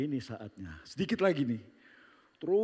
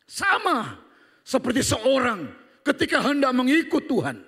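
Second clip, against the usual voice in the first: preaching at full force; 11 semitones higher, 9 dB louder than usual. Acted. Preaching climax.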